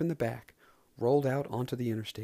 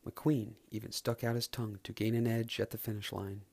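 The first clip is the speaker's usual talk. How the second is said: usual speaking voice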